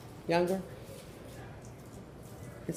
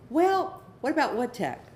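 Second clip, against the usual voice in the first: high, hopeful push of one more idea; hopeful parent; 6 semitones higher than usual. Retold or imitated. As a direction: reach up for one more suggestion. Parent trying another option.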